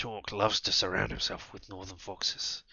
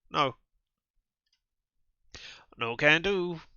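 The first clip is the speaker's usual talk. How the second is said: normal talking voice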